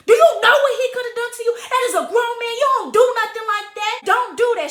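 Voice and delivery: high, loud telling-off; scolding mother. Retold or imitated. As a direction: imitate an upset mama laying down the law. Retold mother scolding her.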